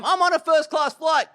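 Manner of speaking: high, smug mock brag; a show-off posting from first class, mocked in a high voice. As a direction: parody someone bragging about a first-class seat. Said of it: "you showcase on Instagram".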